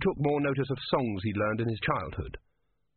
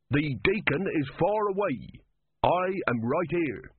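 slow, higher-pitched deliberate emphasis; pulling rank; 6 semitones higher, slower than usual. Acted. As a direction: spell it out slowly, as the one in charge. Sergeant pulls rank on corporal.

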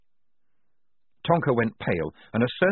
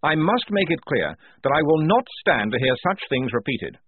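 higher, louder formal address; officious lieutenant; 6 semitones higher, 4 dB louder than usual. Acted. Blouse addressing the confused squad, officious. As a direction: announce it primly, pitch lifted.

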